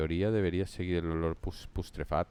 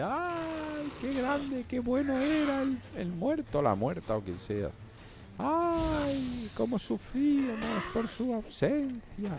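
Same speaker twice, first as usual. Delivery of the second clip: wailing mourner. Retold or imitated. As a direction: wail like a hired mourner. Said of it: "ellas lloraban".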